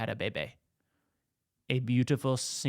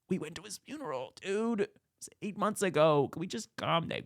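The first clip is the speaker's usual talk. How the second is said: higher-pitched mock pleading; exasperated pleading; 6 semitones higher than usual. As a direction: imitate begging a stubborn colleague to relax. Inspectors beg colleague to calm down.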